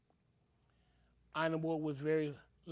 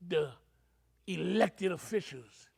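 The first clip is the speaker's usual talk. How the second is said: usual speaking voice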